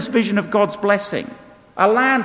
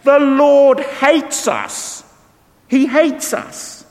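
slow, higher and louder whining complaint; hammed-up self-pitying grumble; grumbling Israelites; 9 semitones higher, 5 dB louder, much slower than usual. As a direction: moan like sulking people. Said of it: "You grumbled in your tents and said".

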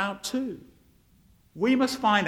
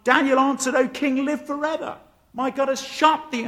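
higher, louder triumphant reading; dramatic shout; 7 semitones higher, 4 dB louder than usual. Acted. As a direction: read it aloud as an excited shout. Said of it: "And the father shouts".

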